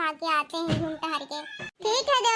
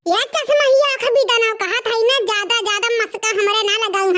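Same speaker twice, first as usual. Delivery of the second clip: put-on louder, lamenting old mother-in-law; scolding mother-in-law; 3 semitones higher, 8 dB louder than usual. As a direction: wail and scold like a shocked village mother-in-law. (बहुओं को डाँटती सास, ऊँची आवाज़ में विलाप)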